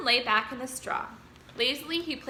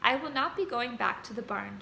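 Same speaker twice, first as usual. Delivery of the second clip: ordinary delivery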